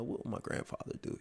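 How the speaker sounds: barely voiced, hushed inner question; whispered to himself. Asking himself what grandfather would do, whispered. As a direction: say it under your breath like a private thought.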